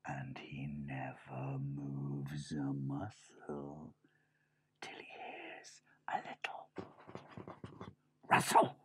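very slow, barely audible whisper; suspenseful whisper. Acted. Mouse brother describing the lurking cat, suspenseful whisper. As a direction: creep along in a hushed whisper.

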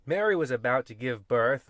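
usual speaking voice

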